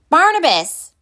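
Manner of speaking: calling a friend from the store front. A narrator's reading. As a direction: call his name from far away. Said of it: "Pip called from the front".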